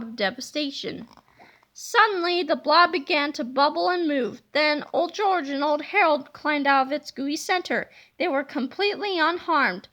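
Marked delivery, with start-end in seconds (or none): none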